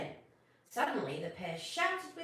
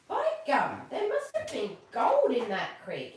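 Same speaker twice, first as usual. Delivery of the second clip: louder, bright burst of discovery with a laugh; excited exclamation; 4 semitones higher, 9 dB louder than usual. Acted. Snugglepot spots gold dust, excited laughing exclamation. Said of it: "exclaimed Snugglepot".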